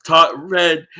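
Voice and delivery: ordinary talk, nothing marked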